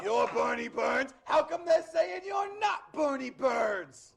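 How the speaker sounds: slow, high Boston shout, building; Boston accent. Retold or imitated. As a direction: bellow it as a little man from Boston. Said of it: "the man from Boston".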